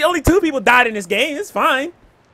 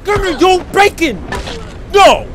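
loud taunting shout; 5 semitones higher, 5 dB louder, slower than usual. Real, not acted. Trash talking enemy.

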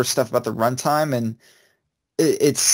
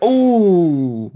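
long "ooh", higher and louder; loud crowd gasp; 6 semitones higher, 5 dB louder than usual. Retold or imitated. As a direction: imitate an audience reacting to a hit. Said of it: "they would gasp really loud".

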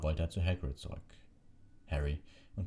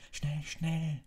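high, strained moan of urgency; urgent groan; 12 semitones higher than usual. A narrator's reading. Hermine pushing Harry to hurry, high groan. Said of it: "stöhnte Hermine".

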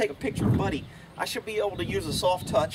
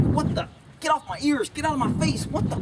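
higher, indignant horse voice; voicing the panicked horse; 6 semitones higher than usual. Trainer voices a horse annoyed by a clumsy haltering, higher. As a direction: play the horse fed up and startled. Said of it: "the horse is like".

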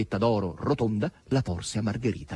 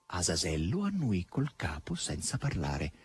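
plain reading voice

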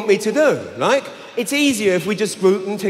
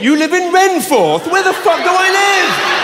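exasperated shout; 10 semitones higher than usual. Real, not acted. Exasperated outburst at confusing names.

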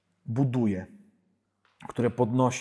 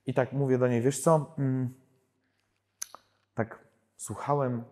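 ordinary talk, nothing marked

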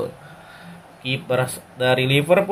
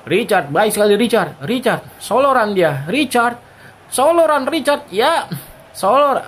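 excited; 7 semitones higher than usual. Real, not acted. Commentator building on an attack.

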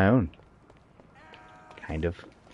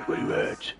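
rough whispered Batman growl, soft; a hint of the Dark Knight hunting; 8 dB quieter than usual. Shown as a demonstration. Gamer hunting enemies, doing a whispered Batman growl. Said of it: "I'm doing my Batman voice".

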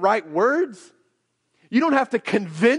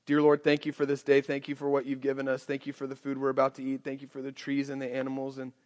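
soft, hurried rote grace; mindless rote prayer; 7 semitones lower, 8 dB quieter, faster than usual. Retold or imitated. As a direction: rattle it off like a grace said by heart. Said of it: "I think about mindless repetition".

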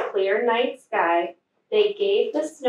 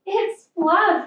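high bright call, a touch louder; yelled; 9 semitones higher, 4 dB louder than usual. A narrator's reading. Little Bear yells to his friends, excited call. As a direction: yell out in glee as Little Bear spots Fluff. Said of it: "he yelled".

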